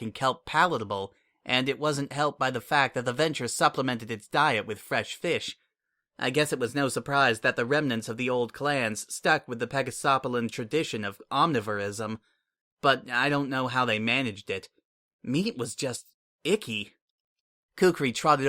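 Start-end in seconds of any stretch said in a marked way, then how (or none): none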